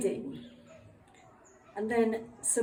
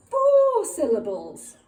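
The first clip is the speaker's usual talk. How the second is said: excited; 9 semitones higher, 9 dB louder than usual. Shown as a demonstration. Teacher building excited emphasis.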